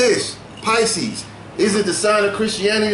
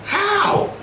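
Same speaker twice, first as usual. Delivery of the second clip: incredulous how; 11 semitones higher than usual. Real, not acted. Mock incredulous question.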